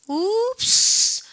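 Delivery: high, long-held rising "웁스"; drawn-out exclamation. Shown as a demonstration. Teacher mocks a spelling with piled-up vowels, drawn-out exclamation. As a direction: stretch the vowel of "웁스" upward, cartoon style.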